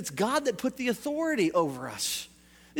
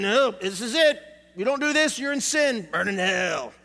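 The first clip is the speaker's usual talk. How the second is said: mock legalist; 4 dB louder than usual. Mimics legalistic church people condemning.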